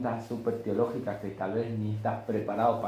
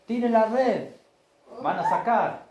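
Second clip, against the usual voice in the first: high, loud call to the boat; shouted instruction; 11 semitones higher, 6 dB louder, slower than usual. Acted. Jesus shouts to the fishermen to cast the net, high and loud. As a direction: order them across the water.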